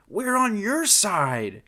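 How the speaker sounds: high, slow, emphatic protest; shouted protest. Acted. Thug protesting to the biting bird, shouting slowly. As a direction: call it out, drawn out and insistent.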